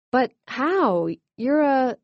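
high, startled, halting; sputtering. A narrator's reading. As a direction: stumble over the words in shock. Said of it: "sputtered Megan".

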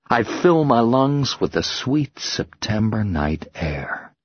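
ordinary delivery, nothing marked

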